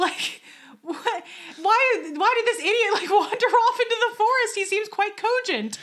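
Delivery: high, loud rant breaking into laughter; bothered rant. Real, not acted. Podcaster laughs at the lamb wandering off, high exasperated rant. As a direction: laugh through your own outrage. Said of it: "so I'm so bothered, and you can hear it".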